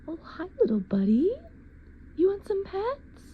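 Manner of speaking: put-on high cooing baby talk to a kitten; pet baby talk. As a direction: coo up high at the little cat.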